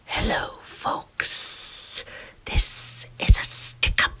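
slow whispered stick-up threat; menacing whispered villain voice. Acted. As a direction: whisper it like a sneaky snake robber. Serpent robber's stick-up.

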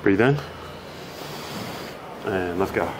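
low, soft coaching voice for breathing; low soothing stretch coach; calm breathing cues. Acted. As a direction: guide the client through each breath, softly.

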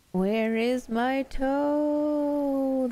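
high, rising haunting wail; very slow, stretched-out spooky moan; groaning ghostly voice. A narrator's reading. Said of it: "the voice groaned".

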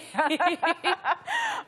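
high bursting laugh. Real, not acted. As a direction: break into a real laugh.

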